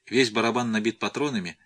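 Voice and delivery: usual speaking voice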